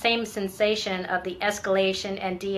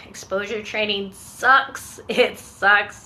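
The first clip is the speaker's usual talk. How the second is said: rueful laugh; 4 dB louder, slower than usual. Real, not acted. Laughs about exposure training.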